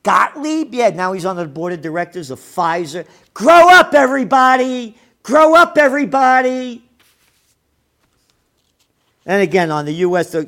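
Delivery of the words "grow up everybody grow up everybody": loud, high exasperated yell; shouting. Real, not acted. Fed up with everybody, he yells at his listeners. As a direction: bellow it at the whole world. Yelling at the audience.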